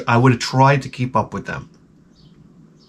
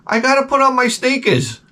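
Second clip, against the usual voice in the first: put-on whiny kid voice; 11 semitones higher than usual. Son answers dad.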